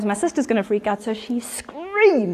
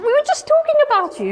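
very high, excited gush; over-eager; 3 dB louder than usual. Retold or imitated. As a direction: call after her in a high, keen voice. Mother calling after departing woman.

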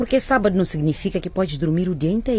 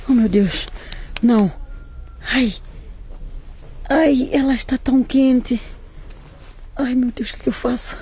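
a touch of panic, some lines higher; panicked; 4 semitones higher than usual. Acted. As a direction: cry out in fright over her feverish daughter. Mother panicking over sick daughter. (aflita, com algum pânico na voz)

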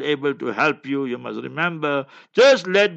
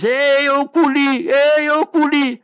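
high, loud taunting yell from the stands; retold jeering shout; 9 semitones higher, 4 dB louder than usual. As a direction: call it out like a mocking crowd. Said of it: "They shouting".

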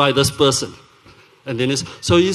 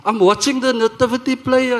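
higher-pitched retold irritation; mock impatience, pitched up; mildly irritated; 7 semitones higher than usual. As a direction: brush it off, a touch annoyed. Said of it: "I'm getting a little irritated".

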